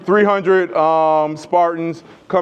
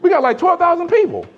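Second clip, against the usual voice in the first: much higher, quick scoffing soldier voice; mocking soldiers; 10 semitones higher, faster than usual. Persians scoffing at the spartans, a high mocking voice. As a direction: sneer at the tiny army. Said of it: "his people are like".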